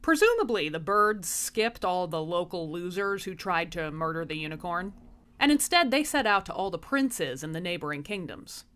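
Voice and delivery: ordinary delivery, nothing marked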